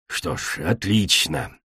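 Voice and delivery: low, soft, snorted remark; dismissive snort. A narrator's reading. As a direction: mutter it with curt disdain. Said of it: "фыркнула Кречет". (тихо, презрительно фыркая)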